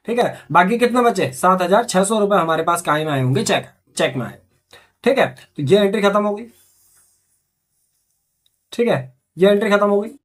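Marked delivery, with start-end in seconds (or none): none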